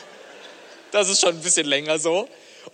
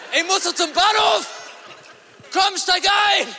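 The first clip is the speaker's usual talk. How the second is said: shout; 7 dB louder, slower than usual. Retold or imitated. Replaying the driver's shout.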